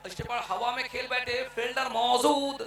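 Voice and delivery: normal talking voice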